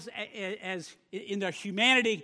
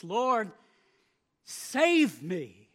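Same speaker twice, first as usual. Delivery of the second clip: mild cry for help; 4 dB louder, much slower than usual. Acted. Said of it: "he cried out to Jesus".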